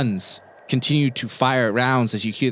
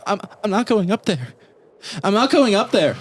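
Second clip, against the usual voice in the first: put-on high-pitched child refusing, growing louder; stubborn kid; 10 semitones higher, 3 dB louder, faster than usual. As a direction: dig in like a frightened little boy, building to a cry. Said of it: "The, the kid's like".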